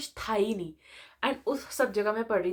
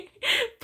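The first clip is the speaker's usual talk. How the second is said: high giggle; 13 semitones higher than usual. Real, not acted. Laughs at the late-night call.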